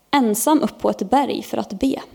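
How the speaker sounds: ordinary delivery, nothing marked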